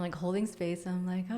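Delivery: ordinary delivery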